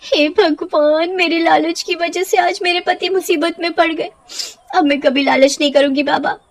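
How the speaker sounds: mild anguished plea; distressed wife. Acted. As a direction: beg the holy man for help. Wife distressed before baba.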